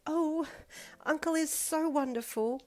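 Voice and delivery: high, slow gushing voice. A narrator's reading. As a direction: gush with delight. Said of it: "Momo gushed".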